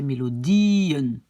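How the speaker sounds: "mélodien" slow, stressed long I, then -en; prononciation modèle. Shown as a demonstration. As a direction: swell on the I of "mélodien", fall onto -en. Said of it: "c'est pour la prononciation". (« mélodien » lent, I accentué puis nouvelle syllabe -en)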